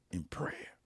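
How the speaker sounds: whispered, almost no voice; hushed. Real, not acted. Preacher sealing his point on prayer, whispered. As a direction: let the last words fall to a whisper. Sermon point drops to hush.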